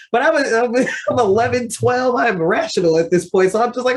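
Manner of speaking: usual speaking voice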